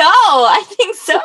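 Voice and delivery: real laughing yes. Real, not acted. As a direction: say it while laughing. Laughing agreement to Florida trip.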